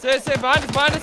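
loud, high alarmed warning; urgent shout. Real, not acted. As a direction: yell the threat behind. Enemy behind squad.

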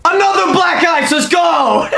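high, loud triumphant shout; excited celebration. Real, not acted. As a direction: shout it out in victory. Celebrates another pull.

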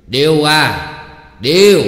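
high, loud shout for someone out of sight; calling out loudly. A narrator's reading. Husband shouts for the girl.

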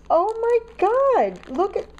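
real, thrilled astonishment; astonished. Real, not acted. Astonished by handmade butterflies.

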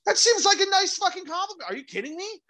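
incredulous. Real, not acted. Incredulous outburst about compliments.

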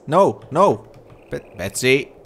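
loud, high scolding call; shouting no. Real, not acted. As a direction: stop the animal with a sharp shout. Shouting at animal escaping.

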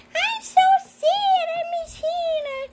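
very high, slow mock-sad toy voice; crying Bosco. Voicing Bosco who misses Hannah, high pretend crying. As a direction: whine it like a tiny sobbing toy. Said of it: "Bosco cry".

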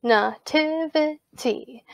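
"nativity" in four measured beats; syllable by syllable. Shown as a demonstration. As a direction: separate each syllable of "nativity". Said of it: "listen very carefully to how I say those".

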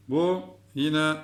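ordinary talk, nothing marked

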